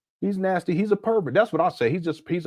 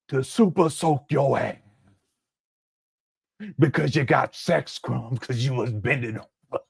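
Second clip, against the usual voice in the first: slow, measured mock oratory, settling lower; Martin Luther King; 3 semitones lower than usual. Commentator finishing a mock dream speech with a crude turn, slow solemn parody. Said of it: "Martin Luther King giving a speech".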